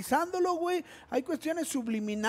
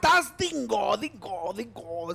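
mock troll voice; 2 semitones lower, slower than usual. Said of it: "lo dice de una manera peculiar".

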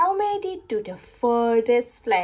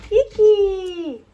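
hiccup; 9 semitones higher, 5 dB louder than usual. Acted. Hiccup laugh after tornado.

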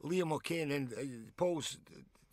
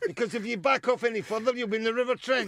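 fast, louder, higher scolding; mock shout; 9 semitones higher, 9 dB louder, faster than usual. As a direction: fire it off at the player. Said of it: "But his voice got louder".

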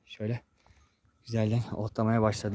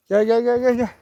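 high, louder repeated herding call; 10 semitones higher, 7 dB louder than usual. Real, not acted. Vlogger calling the sheep to come, high loud repeated call.

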